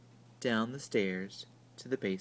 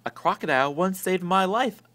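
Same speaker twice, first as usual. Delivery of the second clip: announced; 6 semitones higher, 7 dB louder than usual. A narrator's reading.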